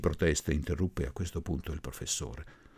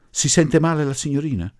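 raised, higher, put-on solicitude; feigned concern; 9 semitones higher, 7 dB louder than usual. A narrator's reading. Groom's relative asking after the bride, feigned concern. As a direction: ask with insincere concern. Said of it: "domandò con simulata premura".

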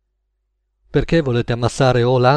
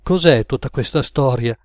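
usual speaking voice

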